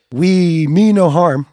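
solemn olive-branch speech, played for laughs; mock peace proclamation. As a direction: declare a truce like a formal address. Mock formal peace address.

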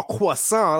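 croissant said the affected French way, "kwa-SAHN"; mock French accent. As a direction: put on a pretentious French accent for croissant, nasal ending and silent T. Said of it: "say croissant with an accent".

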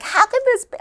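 high, anguished cry of disbelief; replaying her own stunned outcry, pitched high. To her husband, recalling her stunned outburst, high anguished disbelief. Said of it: "'cause I was like".

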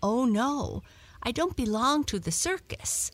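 a hint of innocent denial; protesting cat. A narrator's reading. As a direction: mildly protest in a lilting high voice. Cat denies the dog's suggestion.

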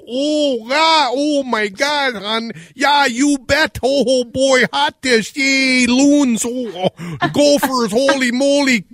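high-pitched, exaggerated Minnesota accent; over-the-top Minnesota accent. Radio host mocking every Fargo character, over-the-top Minnesota accent. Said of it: "exaggerated Minnesota accent".

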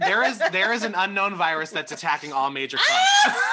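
loud, high, laughing out the words; shouting through laughter. Real, not acted. Joke news about a club virus.

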